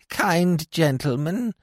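put-on slow, sing-song old woman's whine; a lightly voiced beggar woman whining; whined. A narrator's reading. Old woman whining to the stranger in sing-song. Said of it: "she whined in a sing-song voice".

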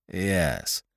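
low, soft, hesitant yes; reluctant, doubtful murmur. A narrator's reading. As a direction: answer quietly, as if unsure. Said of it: "His voice sounded rather doubtful".

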